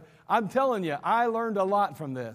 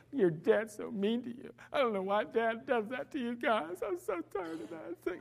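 softer, mocking complaining mother; lightly voiced whining mom; mock whiny mom; 4 semitones higher, 7 dB quieter than usual. Mother the kids never heard, mock whine, softer. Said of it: "She didn't go".

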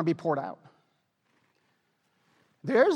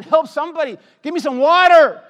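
desperate plea; 7 semitones higher, 11 dB louder than usual. Pretend. Dying man in desert pleads.

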